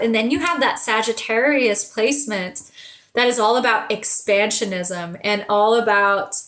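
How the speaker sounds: ordinary delivery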